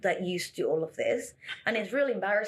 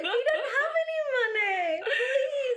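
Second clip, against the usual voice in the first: high, laughing, whimpering plea; a hammed-up laughing beg for mercy; pleading puppy eyes; slower than usual. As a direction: play the broke-backpacker card shamelessly. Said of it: "blinking your eyes".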